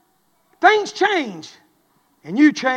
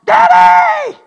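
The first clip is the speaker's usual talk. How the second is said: high, loud drawn-out yell imitating daughter; mimicked child's shriek for help; daughter's holler; 13 dB louder than usual. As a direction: holler for Dad across the house. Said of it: "she hollers".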